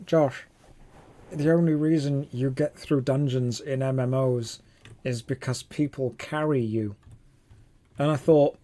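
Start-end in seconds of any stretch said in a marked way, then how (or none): 0.0-6.9 lightly put-on voice of a detractor; stock critic voice. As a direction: mildly mimic someone lecturing you. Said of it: "this is the voice that we do".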